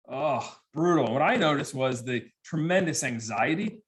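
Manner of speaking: usual speaking voice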